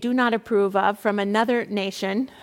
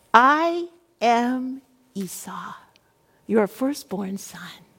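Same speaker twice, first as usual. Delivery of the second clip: deliberate lie; much slower than usual. Acted. Jacob lying to his father, slow and hushed.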